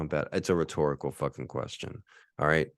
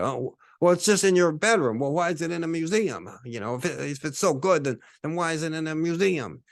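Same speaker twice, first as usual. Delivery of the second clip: higher, dismissive mock-critic voice; higher scoffing-critic voice over a painting; 9 semitones higher than usual. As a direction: sneer at the painting like a snob. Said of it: "people could look at it".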